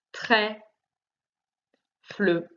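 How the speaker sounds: "Très. Fleu" as two isolated syllables; split into syllables. Shown as a demonstration. Teacher showing the first syllable of the last word, split into syllables. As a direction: pause between "Très" and "Fleu", final e sounded. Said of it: "Première".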